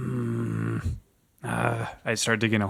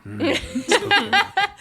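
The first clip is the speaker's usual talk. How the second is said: laughing mimicry; 6 dB louder, slower than usual. Player mimics genie, laughing.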